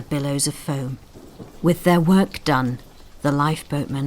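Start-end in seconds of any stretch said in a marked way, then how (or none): none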